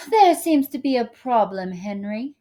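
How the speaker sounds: a light fake English accent. A narrator's reading. Star speaking to her driver Henry in a fake English accent. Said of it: "she said in a fake English accent".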